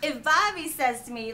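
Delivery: ordinary talk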